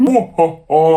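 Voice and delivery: low, loud mock evil laugh; cartoon villain laugh. Imitating the housing company villains, hammed-up evil laugh. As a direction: ham up a slow villain's cackle. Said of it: "they were a little bit cartoonish villain".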